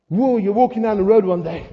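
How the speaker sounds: ordinary talk, nothing marked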